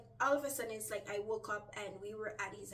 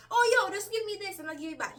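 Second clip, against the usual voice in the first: high, loud, upbeat imitation; breezily ask, imitating a happy borrower; jittery and happy; 9 semitones higher, 10 dB louder than usual. Man casually asking for cash, jittery and happy. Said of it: "still jittery and happy".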